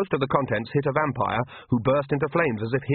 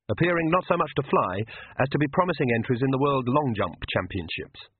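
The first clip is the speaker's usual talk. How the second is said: ordinary delivery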